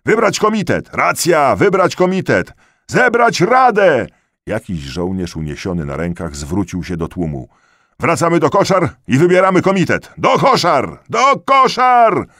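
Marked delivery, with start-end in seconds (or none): 0.1-4.1 very high, loud rallying cries; crowd shouts. A narrator's reading. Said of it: "Z tyłu odezwały się okrzyki". 8.0-10.1 loud, high address to a crowd; rally the whole crowd; shouted rallying call. A narrator's reading. Soldier addressing the crowd from shoulders. 10.2-11.0 high, loud, quick crowd cry; rallying shout. A narrator's reading. 11.1-12.3 very high, drawn-out yell; escalated rallying shout. A narrator's reading.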